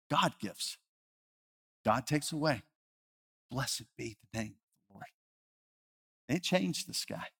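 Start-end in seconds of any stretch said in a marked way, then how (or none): 0.1-5.1 softer, reverent repeat; a quiet, reverent echo of her words; hushed echo. Acted. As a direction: let it fall away to near silence. Echoing widow's words slowly.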